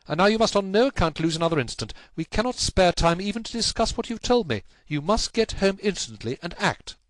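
high-pitched, pressing orders; urgent command. Acted. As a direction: send the client off without delay. Holmes urges client to act immediately.

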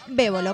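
Bébolo quoted with BÉ stressed; accent on the E. Retold or imitated. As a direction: stress the first syllable of Bébolo. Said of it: "con acento en la E".

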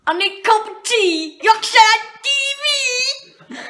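hammed-up Yorkshire accent, stretching out the vowels. Nephew from Australia showing his uncle a Yorkshire accent, exaggerated and singsong. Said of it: "Can you do accent?".

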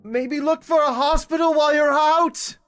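high, projected parting call; calling after. Acted. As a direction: call out loudly after someone leaving. Calling after someone who is leaving.

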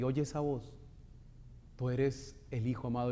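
usual speaking voice

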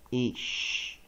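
ish with a long, hushing sh; short i, then stretch the sh in ish; sound-blending demonstration. Shown as a demonstration. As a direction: ish said alone, the sh held. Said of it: "所以我们就念".

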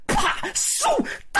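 high sharp snap, whoosh and clang; storyteller's onomatopoeia; slingshot sound effects. A narrator's reading. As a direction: pop out the shot like a sound effect.

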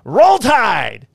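loud high football cheer, Roll Tide; yelling Roll Tide. Retold or imitated. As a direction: shout it like a stadium chant. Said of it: "I'm in the background going".

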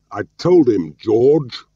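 loud, high-pitched angry outburst; furious shout. A narrator's reading. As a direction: shout it in exasperated fury. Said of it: "he shouted furiously".

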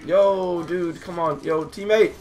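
high, loud pleading shouts; high-pitched, building gamer alarm; urgent calling out. Real, not acted. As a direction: yell for your teammate's attention. Calling to teammate for help.

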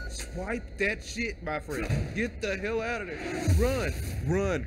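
high urgent shouting; being chased. Real, not acted. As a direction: urge it on frantically. Urging escape from monster.

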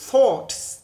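Thoughts with the tongue between the teeth and an unvoiced th; voiceless th. Shown as a demonstration. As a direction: push air past the tongue tip for Thoughts. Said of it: "Âm vô thanh".

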